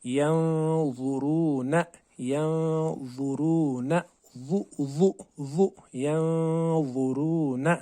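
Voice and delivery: यंज़ुरून drawn out very slowly with a thick "zau"; heavy zaa. Shown as a demonstration. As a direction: chant यंज़ुरून slow and heavy. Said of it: "अगर हम यंज़ुरून पढ़ेंगे".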